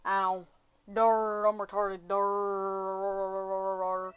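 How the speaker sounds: low, droning dim-witted voice; dopey mock computer. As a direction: drag out a long monotone "durr". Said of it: "O B S was like".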